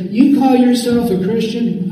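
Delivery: high-pitched mocking accuser; Satan. Devil accusing a believer who has sinned, high accusing voice. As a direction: sneer it in a high put-on voice. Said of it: "I don't know what his voice sounds like".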